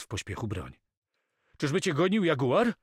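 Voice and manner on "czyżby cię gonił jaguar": high, loud questioning rise; startled question. A narrator's reading. Carmo asks Moko whether a jaguar chased him, alarmed. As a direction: ask in raised, urgent alarm.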